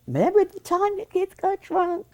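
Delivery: loud, very high mock excitement; gleeful reminiscing. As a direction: squeal it like delighted gossip, about to laugh. Acting out gleeful retelling of gossip.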